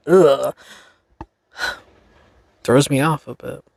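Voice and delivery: ordinary talk, nothing marked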